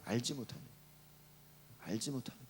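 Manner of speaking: low, soft echoed question; hushed reproach. Retold or imitated. Preacher repeating why they fail to realise, hushed. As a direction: murmur the reproach, pitched well down.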